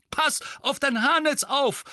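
high, alarmed shout; calling out. A narrator's reading. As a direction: shout while ducking blows. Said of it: "rief Mandangus".

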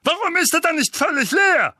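very high, loud outburst; Onkel Vernon, flaring up. Acted. Uncle demands why son still has soul, outraged. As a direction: hurl the challenge at peak pitch.

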